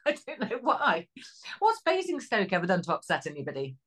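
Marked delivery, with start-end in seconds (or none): none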